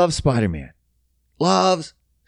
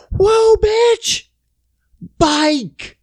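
high, loud wailing shouts; drawn-out falsetto mock yells; kids freaking out; 3 dB louder, much slower than usual. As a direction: imitate shrieking kids in alarm. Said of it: "all these kids freaking out".

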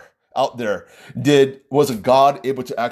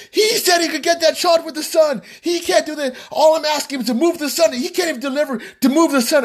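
high-pitched mock bridezilla rant; hysterical complaining; 14 semitones higher than usual. Angry bride complaining about the wedding planner, hysterical high rant.

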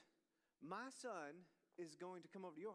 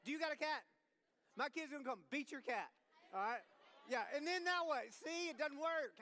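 mock outrage; 5 semitones higher, 6 dB louder than usual. Real, not acted. Mock advice yelled at audience.

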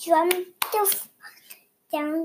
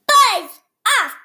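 loud, high bossy shout; 7 semitones higher, 5 dB louder, slower than usual. A narrator's reading. Beast shouting the dragon away, loud. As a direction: bellow to drive him off.

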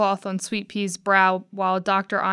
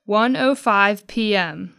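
plain reading voice

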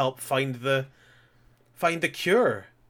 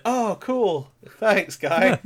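perky relief; 7 semitones higher, 5 dB louder, much slower than usual. Retold or imitated. Dying woman casually accepts rescue.

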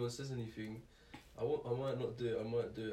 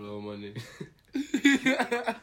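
stoned; 13 dB louder, much slower than usual. Real, not acted. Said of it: "very high right now".

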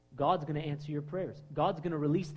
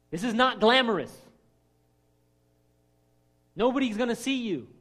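preaching emphatically; 6 semitones higher, 7 dB louder than usual. Real, not acted. Preacher exhorting congregation.

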